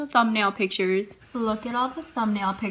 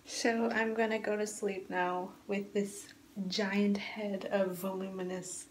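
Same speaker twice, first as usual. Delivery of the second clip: much softer, slow late-night murmur; quiet bedtime voice; 8 dB quieter, slower than usual. Real, not acted. Vlogger checks in hours later before going to sleep, hushed. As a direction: keep it hushed and unhurried, winding down for bed.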